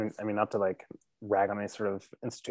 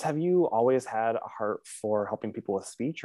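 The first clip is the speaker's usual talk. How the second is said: ordinary talk, nothing marked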